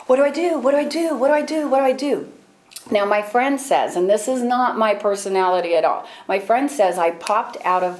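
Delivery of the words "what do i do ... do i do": frantic, not knowing what to do; panicked repeating. Retold or imitated. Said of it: "I'm just sitting there going".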